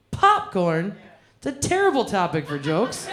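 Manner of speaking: ordinary talk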